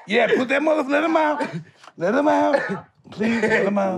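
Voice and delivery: high-pitched, eager pleading; excited urging. Real, not acted. Urging the dove's release on video.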